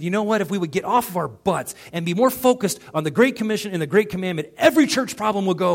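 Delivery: loud, high, impassioned proclamation; fired-up preaching. Retold or imitated. Preacher retells telling his friend off, loud and fired up. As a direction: deliver it like a rallying cry.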